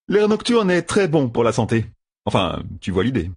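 high, breezy joker's voice, dropping at the end; cheeky young gambler. Acted. Matt joking to the armed Seleucia, cheeky. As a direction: toss off a flippant excuse.

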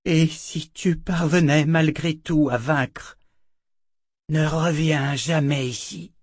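slow, high, halting farewell; broken-voiced warning. A narrator's reading. Shota forbids Richard to return, broken-voiced and slow. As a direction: warn slowly with a breaking voice. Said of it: "dit-elle d'une voix brisée".

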